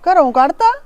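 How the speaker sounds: can't believe it; mother stunned by the news; shocked. Acted. Mother hears daughter expelled.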